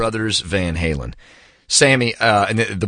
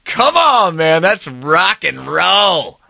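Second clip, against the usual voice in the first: high, drawn-out excited exclaim, louder; excited gush; 10 semitones higher, 5 dB louder, much slower than usual. Real, not acted. Host marveling at the Ferrari story, in a much higher, slower, excited exclaim. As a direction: gush over it, stretching it slowly.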